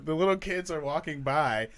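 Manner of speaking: ordinary talk, nothing marked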